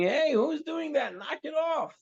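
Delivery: high-pitched retold yell of complaint; high, hammed-up heckler's shout; mock audience screaming. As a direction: imitate an irritated crowd yelling out. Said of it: "everybody in the theater starts screaming".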